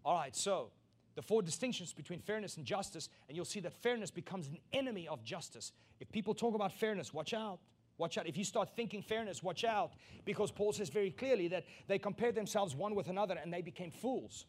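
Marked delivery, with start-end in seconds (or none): 6.0-12.3 raised, urgent pulpit warning; preaching at full force. Acted. Preacher warning the congregation, raised and urgent. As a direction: warn the congregation, voice lifted high.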